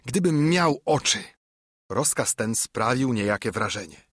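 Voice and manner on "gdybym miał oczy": higher, raised lament; moderately shouted exclamation; crying out. A narrator's reading. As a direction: cry out the wish in frustration. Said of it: "krzyczał".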